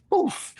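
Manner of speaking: sudden little high whoosh; fire poof. Retold or imitated. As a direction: imitate a flame puffing up. Imitating fire flaring up.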